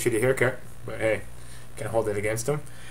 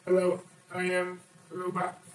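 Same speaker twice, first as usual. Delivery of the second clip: higher, softer robotic voice-changer effect; 6 semitones higher, 5 dB quieter than usual. Shown as a demonstration. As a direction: speak through a robot voice filter. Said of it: "I could be a robot".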